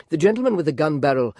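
ordinary delivery

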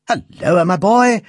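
put-on old uncle calling out gladly; cheerful cry. A narrator's reading. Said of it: "cried the instrument maker".

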